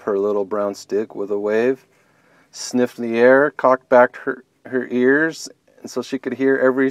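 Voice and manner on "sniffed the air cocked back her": ordinary delivery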